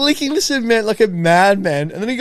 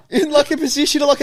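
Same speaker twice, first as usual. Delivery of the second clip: ordinary delivery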